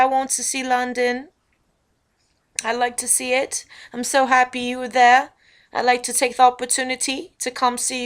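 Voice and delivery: London accent, a little lilting; playful faux-British accent with rising ends; put-on British accent. Shown as a demonstration. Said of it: "I already went right back to doing accents".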